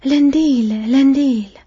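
loud, high war cry, falling at the end; battle cry. A narrator's reading. Aragorn leading the charge into the breach, loud battle cry. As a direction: roar the name as you leap into the breach. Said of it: "فریاد زد".

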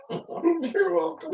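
mock high echo. Repeating Tim in a mock voice.

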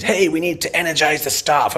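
high, hyped-up stage voice; mocking keynote speaker. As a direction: parody a conference speaker rallying the room. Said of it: "CX keynote speakers. They go on stage".

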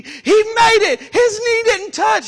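high, loud protest at the TV; imitating his brother's screaming; retold upset yelling. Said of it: "started screaming and crying and yelling".

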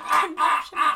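high, loud hyperventilating yelps; hammed-up gasping squeals; repeated comic shrieks; mock conniption shrieks. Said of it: "she had a conniption".

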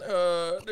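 breaking into a high laugh. Real, not acted. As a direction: laugh out mid-bit. Laughs mid radio bit.

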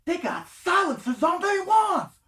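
high, loud hammed-up yell; comic high-pitched holler, words stretched out; sketch character shouting. Re-enacts comedy sketch wizards in Chicago.

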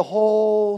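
"whole" held long; drawn-out emphasis. Real, not acted. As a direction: stretch out "whole" to show its length. Stretches the word for emphasis.

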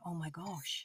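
quietly acted-out sinking realization; hushed mock dismay. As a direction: say it under your voice in mock horror. Narrator's dismay at late discovery.

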